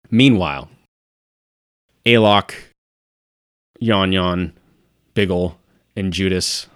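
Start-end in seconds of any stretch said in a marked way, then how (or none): none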